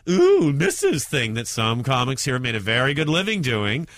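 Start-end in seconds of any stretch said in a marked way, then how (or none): 0.1-1.0 high, loud, camp exclamation; mock a mincing old-school camp act; exaggerated mincy squeal of delight; mincy camp comic. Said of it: "do the whole mincy".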